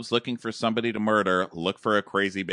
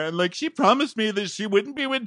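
high, gasping imitation of a wronged woman; hammed-up indignant whine; mock tearful protest; 10 semitones higher, 6 dB louder than usual. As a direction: protest it high, catching your breath. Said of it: "she's still sobbing".

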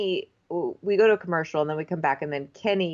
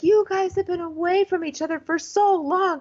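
gushing host; 10 semitones higher than usual. Retold or imitated.

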